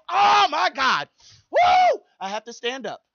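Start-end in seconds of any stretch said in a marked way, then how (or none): 0.1-2.0 high, loud whooping cry of a rider on the drop; thrilled roller coaster shout. Acted. Preacher acting out a rider on the coaster, whooping and thrilled. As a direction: yell it like you're plunging down the track.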